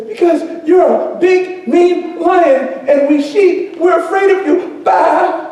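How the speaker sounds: high-pitched little cub voice; frightened lion cub. Acted. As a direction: bleat it out like a scared lamb. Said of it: "is shivering in fear".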